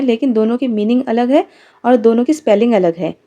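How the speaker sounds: normal talking voice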